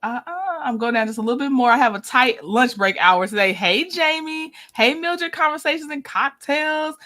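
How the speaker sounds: ordinary talk, nothing marked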